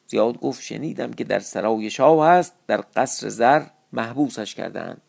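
measured classical storytelling voice; story narration. A narrator's reading. Storyteller reading an old Persian epic to listeners, measured narration. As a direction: read the old tale with formal cadence.

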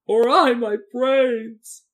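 loud, high, drawn-out wail; tearful Scarecrow lament. A narrator's reading. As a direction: wail it despairingly, stretching every word. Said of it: "wailed the Scarecrow".

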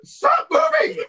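very high, loud, drawn-out yell; exasperated shout. Real, not acted. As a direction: scream at the page that keeps shifting. Yelling at the shifting web page.

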